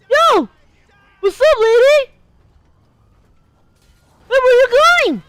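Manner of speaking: high, loud-edged cartoonish voice, switching between English and Spanish; high, exclaiming put-on voice; put-on character voice. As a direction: play a cocky character in a high strained voice. Holds the put-on voice.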